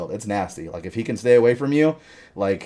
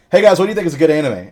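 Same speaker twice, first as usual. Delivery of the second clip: quick, higher mock voice of the clueless friend; 6 semitones higher, 8 dB louder, faster than usual. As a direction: rattle it off like an eager newbie. Retelling friend asking about anime.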